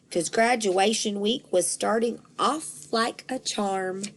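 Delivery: usual speaking voice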